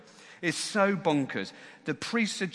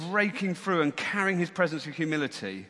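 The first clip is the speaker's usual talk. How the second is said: ordinary talk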